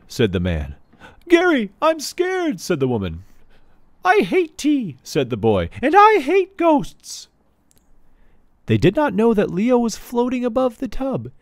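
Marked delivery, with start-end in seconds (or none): 1.3-2.6 high, frightened woman's voice; scared woman. Acted. Wife hiding in the bathroom, scared. 4.0-5.0 put-on high, whiny little boy; sulky boy. Boy in the locked bathroom complaining, whiny. 5.8-7.3 put-on high, whiny little boy; sulky boy. Boy in the locked bathroom complaining, whiny.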